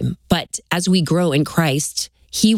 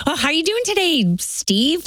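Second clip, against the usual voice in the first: higher, chirpy small-talk greeting to a cashier; fake cheery greeting; 10 semitones higher than usual. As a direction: put on a bright polite customer voice. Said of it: "you're like".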